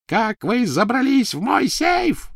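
high, slow witch shriek. A narrator's reading. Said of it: "визжала Белатриса".

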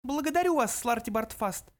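high, icy mouse voice; curt little mouse; cold dismissal. A narrator's reading. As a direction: cut him off coldly in a tiny high voice. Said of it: "холодно отрезал Бенджи".

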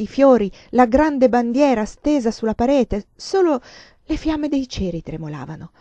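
ordinary delivery

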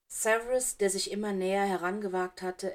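ordinary delivery

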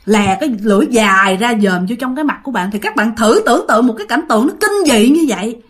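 usual speaking voice

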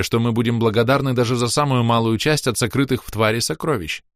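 ordinary delivery, nothing marked

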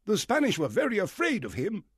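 high, loud, eager squire; excited squire. Acted. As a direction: squire exclaiming about Flint.

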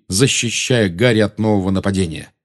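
ordinary delivery

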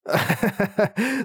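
high breathy laugh; light laugh. Acted. Kaburak laughs after falling.

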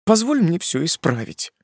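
high, sobbing plea; tearful woman pleads to fix things. Acted. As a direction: sob it out. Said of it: "всхлипнула она".

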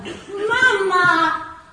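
high, loud cry of relief; calling out. Acted. Daughter cries out to her mother, loud.

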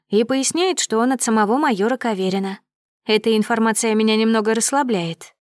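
ordinary delivery, nothing marked

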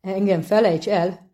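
plain reading voice

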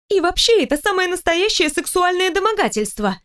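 high-pitched outraged accusation; indignant accusation. A narrator's reading. Employee accusing boss of harassment, outraged. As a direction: pitch it right up in protest.

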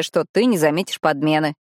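ordinary delivery

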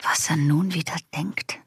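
exasperated whisper to herself; whispered aside. Real, not acted. Patient whispers aside, annoyed at the doctor's theory. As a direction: hiss it under your breath, annoyed.

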